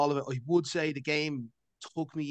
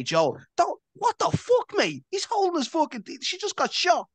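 mock Tommy outburst; 14 semitones higher than usual. Voicing Tommy's exasperated outburst.

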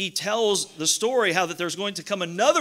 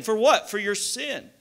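ordinary delivery

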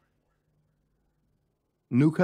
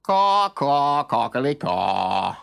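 loud croaky crow song, slow at the end; crow cawing; 3 dB louder, slower than usual. A narrator's reading. Painted crow trying to sing. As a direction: caw like a crow pretending to sing. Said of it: "sang the bird".

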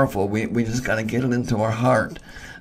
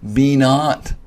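"Be not" punched out louder; emphatic imperative; 2 semitones higher, 5 dB louder than usual. Shown as a demonstration. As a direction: drive home "Be not" as a loud command. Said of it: "it means an emphatic imperative".

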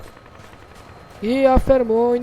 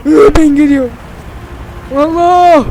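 panicked yelp; 3 semitones higher, 7 dB louder than usual. Real, not acted. Panicking under fire.

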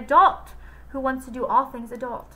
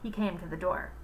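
usual speaking voice

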